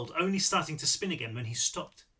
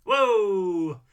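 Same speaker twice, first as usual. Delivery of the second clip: startled whoa; 4 semitones higher, 9 dB louder than usual. Acted. Marlowe startled by the dented car.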